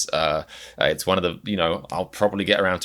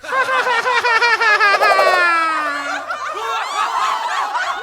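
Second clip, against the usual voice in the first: high, loud shrieking cackle; shrieking laugh; 6 dB louder than usual. Acted. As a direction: burst into a wild, high laugh. Wild laugh opening the video.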